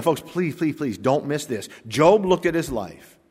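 ordinary talk

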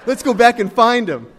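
mock-thrilled shout with a laugh; imitated gleeful zeal. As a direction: play the giddy friend egging everyone on. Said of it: "He's just laughing".

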